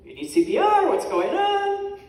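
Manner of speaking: high, loud comic outburst; imitating a startled bystander's shout; alarmed mock yell. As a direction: call out in exaggerated alarm, stretching the end. Voices alarmed audience member in front.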